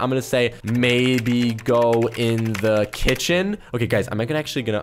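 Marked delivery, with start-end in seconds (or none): 0.6-3.6 very slow, low hint; drawn-out suggestion as you type; typing aloud. Real, not acted. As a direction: stretch each word, rising at the end.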